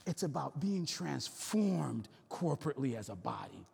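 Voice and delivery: usual speaking voice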